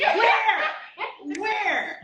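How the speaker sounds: high, squealing where; mock disbelief. Real, not acted. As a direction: act amazed and teasing. Teasing disbelief about age.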